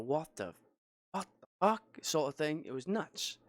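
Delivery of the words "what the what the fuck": shocked exclamation. Retold or imitated. Retelling his shock at John running.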